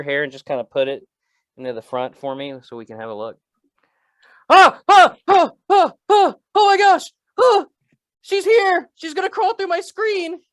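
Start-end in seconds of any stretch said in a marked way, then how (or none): 4.5-10.4 very high, loud hammed-up shrieks; mock terror. Host pretends the ring girl is coming, mock terrified shrieking. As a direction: play panicked horror-victim screams, squealing the words.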